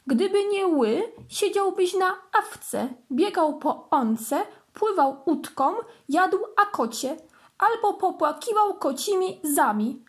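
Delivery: 높은 소리로 장난스럽게 설명; teasing explanation. A narrator's reading. Bench explains missing letter.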